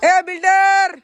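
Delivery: loud call across the yard. Real, not acted. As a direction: shout a name to get attention. Calling out to someone at home.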